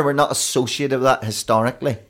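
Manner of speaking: normal talking voice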